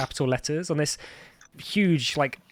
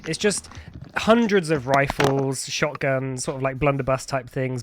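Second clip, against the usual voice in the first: ordinary talk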